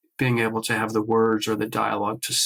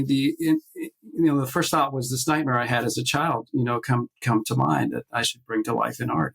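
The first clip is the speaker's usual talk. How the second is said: ordinary talk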